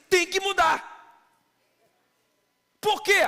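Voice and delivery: high, loud preacher's insistence; forceful preaching. Real, not acted. Pastor answering a stubborn believer, high loud insistence. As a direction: push it up and hammer it.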